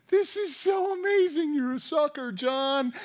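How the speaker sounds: high, gleeful mock taunt; teasing. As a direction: gloat at your friend from the water. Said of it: "teasing the other apostles".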